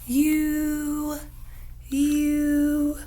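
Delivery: a held, steady "you"; long U demo. Shown as a demonstration. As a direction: model "you" again. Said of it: "show me how to make that sound".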